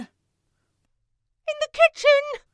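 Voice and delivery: drawn-out, loud call from another room. Acted. Sarah Jane calling to her friend from the kitchen, loud. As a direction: stretch it out to carry through the house.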